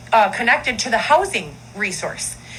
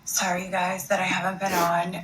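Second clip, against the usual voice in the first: soft apology; 4 semitones lower, 4 dB quieter than usual. Acted. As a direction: coo a sorry to your followers. Recording apology video, soft voice.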